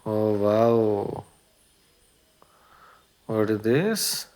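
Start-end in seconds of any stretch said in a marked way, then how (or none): none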